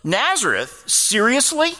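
mock-incredulous, mildly sarcastic retort; sarcastic disbelief. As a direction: imitate a sceptic's incredulous questions, pitch jumping up at the end. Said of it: "kind of sarcastic response was like".

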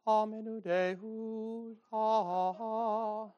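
mock Latin chant, lightly voiced pompous priest. Preacher mocking a priest with collar backwards, mock chant. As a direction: parody a stiff Latin mass. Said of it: "I'm being sarcastic, ain't I?".

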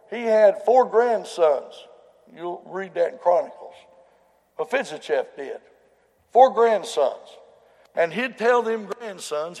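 loud, high, slow preaching, each word driven home; full-force preaching. Acted. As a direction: hammer the numbers out to the church. Preacher building the story again.

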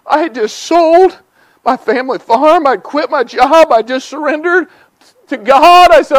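high, loud fervent preacher build; preaching at full force. Acted. Preacher telling the church his own story, preaching loud and high. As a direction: drive it home from the pulpit, climbing.

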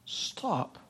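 breathless whispered stop; preacher whispering a dramatic stop. Acted. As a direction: drop to a hush. Dramatic hush in the sermon.

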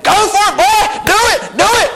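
high, pushy devil voice egging him on; hammed-up tempter urging do it. Devil tempting a believer, high and pushy. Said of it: "The devil's like".

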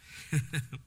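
small self-amused chuckle; a little laugh at oneself; short chuckle. Real, not acted. Chuckles at own surprise over study.

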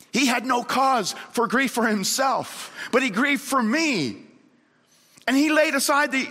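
high, impassioned preacher's cry; ringing proclamation to the congregation; fervent preaching. Real, not acted. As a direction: preach it at full pitch, pausing between phrases. Preaches at full force.

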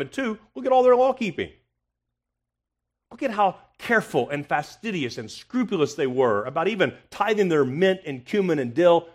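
mock admiration of their scrupulous law-keeping. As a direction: scoff at how careful they were. Mocking the Pharisees' law-keeping.

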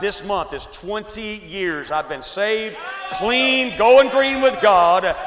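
loud, rising sermon build; preaching at full force. Acted. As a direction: build the crowd to a roar. Preaching climax.

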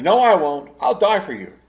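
loud, high-pitched protest; arguing. Acted. Peter disputing with Jesus over denying him, arguing. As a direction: argue back with forceful conviction. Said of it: "he's, he's arguing".

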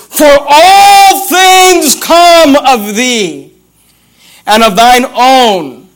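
very slow, long-held "all things"; slow proclamation. Shown as a demonstration. Savours favourite verse, then repeats it.

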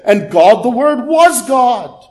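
high, loud stress on "was"; emphatic proclamation. Real, not acted. Climax of preached verse.

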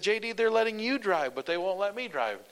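high, loud mock whine; high-pitched teasing gripe; whiny complaint. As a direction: complain to your buddy, put out. Complaining to friend J D.

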